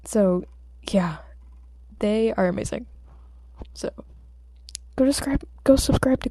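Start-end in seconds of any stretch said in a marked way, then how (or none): none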